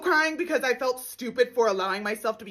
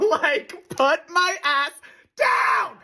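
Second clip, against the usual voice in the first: high laughing yell; laughing shout; 5 semitones higher, 4 dB louder, much slower than usual. Acted. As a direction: shout it half-joking. Begging to be stunned.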